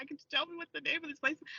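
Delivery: mildly tearful, hurried question; holding back tears. Retold or imitated. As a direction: imitate asking while fighting tears. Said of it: "trying not to cry".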